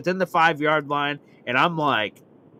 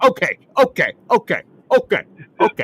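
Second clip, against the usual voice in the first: louder, higher imitation of fed-up dad; getting aggressive; 6 semitones higher, 6 dB louder than usual. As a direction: fire off repeated warnings to back off. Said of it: "getting all aggressive".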